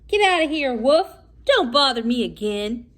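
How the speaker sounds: loud, rude pig shouting; rude reply. A narrator's reading. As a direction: bark at the wolf to leave. Rude little porker answered.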